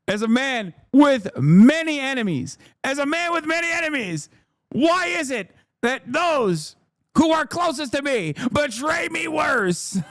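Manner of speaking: high, hammed-up lament; mock tragic speech. Streamer mourning his friends' betrayal in mock tragedy. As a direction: deliver it like a betrayed king.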